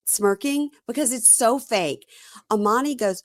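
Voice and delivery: ordinary talk, nothing marked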